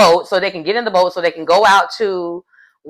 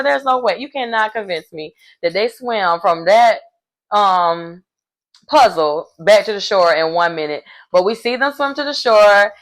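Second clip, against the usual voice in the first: normal talking voice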